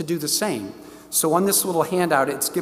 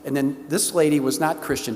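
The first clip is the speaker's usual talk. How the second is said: ordinary talk, nothing marked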